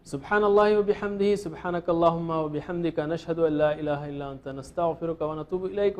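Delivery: quiet, fast closing supplication under the breath; rapid murmured prayer. Real, not acted. Teacher closing the lesson with a whispered-fast dua, quiet and rapid. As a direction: rattle off the closing dua softly and quickly.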